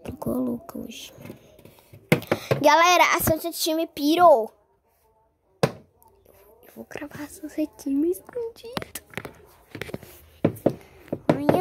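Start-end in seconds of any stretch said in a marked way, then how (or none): 0.0-1.1 unvoiced whispered aside; hushed whisper. Real, not acted. 5.6-9.1 slow, unvoiced whisper; secretive whisper. Secret filming plan.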